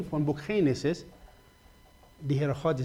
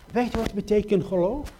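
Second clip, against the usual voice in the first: usual speaking voice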